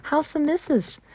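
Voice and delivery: a friendly Yorkshire query, lightly voiced; station master asking after the wife in a touch of Yorkshire; light Yorkshire accent. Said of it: "broad fashion which Mary found out afterwards was Yorkshire".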